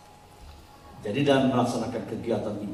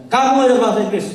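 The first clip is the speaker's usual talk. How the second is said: pushed, louder and higher, fast; insisting ustaz; 7 semitones higher, 6 dB louder, much faster than usual. Retold or imitated. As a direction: press the question hard like a demanding teacher. Ustaz mendesak lagi, lebih keras. (ustaz mendesak, lebih keras dan cepat)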